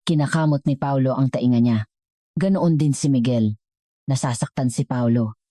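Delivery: usual speaking voice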